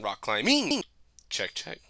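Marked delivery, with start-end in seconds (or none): 0.0-0.8 "rock climbing" with the final "ing" pushed high; stress on -ING. Retold or imitated. Imitating friend's guessing habit, "rock climbing" with exaggerated "ing". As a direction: lean hard on the "ing" of "climbing". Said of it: "put that inflection on the ing at the end".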